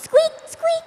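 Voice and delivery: very high squeaky mouse voice; mouse squeak. Acted. The little gray mouse is introduced, squeaking.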